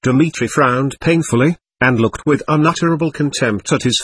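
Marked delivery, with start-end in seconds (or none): none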